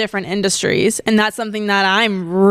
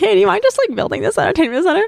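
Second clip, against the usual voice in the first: high, fast, overly sweet favour request; innocent sweet ask; 9 semitones higher, faster than usual. Retold or imitated. Slipping the real request to her friend, innocently sweet. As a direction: play innocent while asking a big favour. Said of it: "I'm like".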